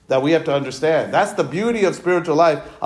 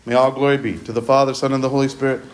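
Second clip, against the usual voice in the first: prayerful doxology; 5 semitones lower, faster than usual. Acted. Closing doxology.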